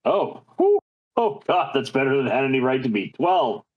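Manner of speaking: normal talking voice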